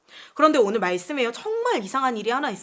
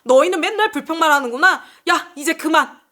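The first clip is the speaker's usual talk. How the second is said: loud, higher acted telling-off; mock divine outburst, raised voice; angry scolding; 4 semitones higher, 6 dB louder than usual. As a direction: play God fed up with complainers. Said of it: "이렇게 화를 내셨을까요?".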